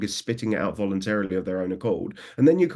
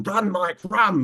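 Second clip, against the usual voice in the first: high, lightly raised mock call to a static handler; urging a trainee to move, hammed up a little; 11 semitones higher, 4 dB louder, much slower than usual. Trainer calling to handler Mike to run, light high call. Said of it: "I used to say".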